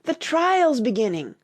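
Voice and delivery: high, loud announcement; cry. A narrator's reading. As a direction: call it out as if from across a court. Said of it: "when a cry of".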